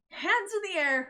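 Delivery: ordinary delivery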